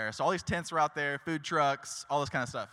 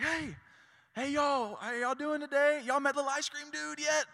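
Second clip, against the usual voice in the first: much higher, bright hawking voice calling to passersby; eager street promoter; 12 semitones higher than usual. Retold or imitated. Promoter outside the ice cream truck greeting passersby, much higher eager call. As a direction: hail everyone like a cheerful barker. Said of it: "he's like".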